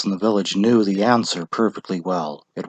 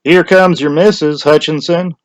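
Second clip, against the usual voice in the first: lightly voiced villager, a bit higher; townsfolk; 7 semitones higher, 6 dB louder than usual. A narrator's reading. Villagers tease the husband, lightly voiced. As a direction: call it across the crowd. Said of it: "Two or three people said".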